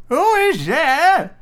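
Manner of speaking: put-on high, slow, loud old-lady call; old grandmother voice. Grandmother calls through the door.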